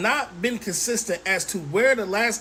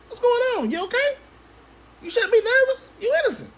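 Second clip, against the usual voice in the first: high, sing-song mock sympathy, much higher; mock concern; 13 semitones higher than usual. Host taunting the nervous suspect with mock concern. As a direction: tease him with fake concern, pitched way up.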